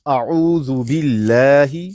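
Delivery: drawn-out tajweed recitation for the student to copy; slow Quran recitation. Shown as a demonstration. Teacher recites slowly for the student to repeat. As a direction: recite slowly, stretching each vowel. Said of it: "اچھا جی، شروع کرتے ہیں".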